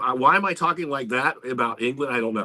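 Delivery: usual speaking voice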